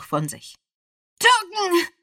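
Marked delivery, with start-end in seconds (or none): 1.2-1.9 very high, loud shriek. A narrator's reading. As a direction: scream the warning. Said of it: "kreischte er".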